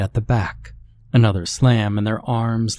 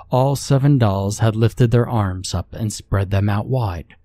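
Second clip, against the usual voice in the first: usual speaking voice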